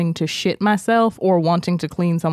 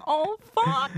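mock porn actress; 6 dB quieter than usual. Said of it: "And she's like".

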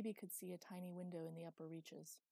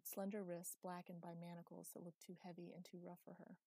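ordinary delivery

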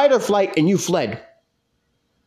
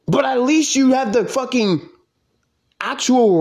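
heated rant; 6 semitones higher than usual. Real, not acted. Angry rant about creator fleeing.

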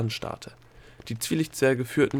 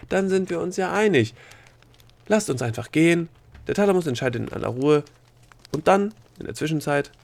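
higher, eager gnome voice; relieved gnome; 7 semitones higher, 3 dB louder than usual. Acted. Gnome urging that they simply leave, relieved.

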